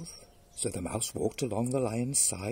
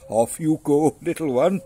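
loud, raised lion roar; 4 semitones higher, 10 dB louder than usual. Acted. Lion dismissing mouse while amused, roaring. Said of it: "he roared".

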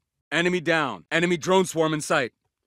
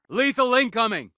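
high alarm to the squad; raised-pitch battle cry; urgent warning; 6 semitones higher than usual. Acted. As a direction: call out incoming danger. Combat warning.

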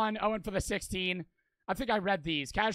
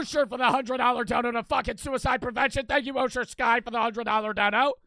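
loud, raised donation shout-out; excited call-out; 4 semitones higher, 7 dB louder than usual. Real, not acted. Streamer thanks donor for a hundred dollars, loud and excited. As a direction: call out the big donation with hype.

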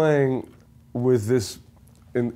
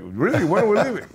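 eager disbelief; 8 semitones higher than usual. Retold or imitated. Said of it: "I just like".

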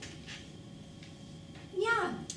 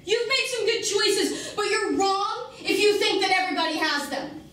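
raised, higher-pitched bitter tirade; angry outburst; 5 semitones higher, 3 dB louder than usual. Acted. Woman confronting Mikey about having no choices, angry outburst. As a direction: let frustration boil over, loud and high.

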